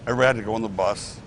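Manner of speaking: ordinary delivery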